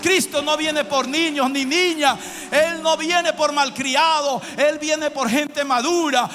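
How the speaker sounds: loud, high, impassioned preacher shout; full-force preaching. Real, not acted. Pastor telling his church whom Christ comes for, full-force preaching. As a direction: thunder it out to the congregation, stretching key words.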